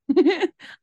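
loud, high burst of real laughter; cracking up out loud. Real, not acted. Host laughing at the nickname, bursting out loud.

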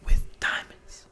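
breathy whisper of with diamonds; slow mock whisper; whispered echo. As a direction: hush it out slow and dreamy. Echoes the line whispered.

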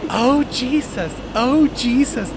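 high, slow, frightened wail; panicked wailing. Retold or imitated. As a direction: mimic a terrified passenger praying aloud. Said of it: "starts going back and forth and rocking".

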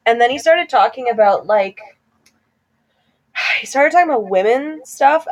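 normal talking voice

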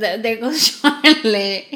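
laughing out the name Charlotte, loud; laughing tease. Real, not acted. Cohost teasing Charlotte about being emotional, laughing loud. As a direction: tease her friend through a laugh.

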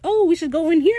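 high, loud, bright-eyed suggestion; eager Steed. Retold or imitated. Steed urging the crew into the bar, high and eager. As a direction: chirp it with eagerness.

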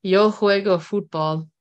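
slow, careful Spanish; a hint of a Spanish accent; bad Spanish accent. Shown as a demonstration. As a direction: say "Yo juego fútbol" slowly in a learner's Spanish accent. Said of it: "my bad accents".

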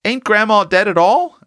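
put-on high-pitched child's question; puzzled child. A narrator's reading. Small boy asks amid kissing, high puzzled question. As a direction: ask it high and innocently.

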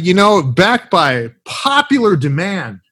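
ordinary delivery

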